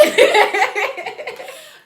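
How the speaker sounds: real shared laughter; loud high laugh. Real, not acted. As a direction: break into a big high laugh. Both laughing at miscommunication.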